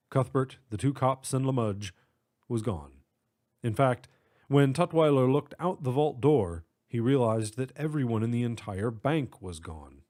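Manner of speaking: ordinary delivery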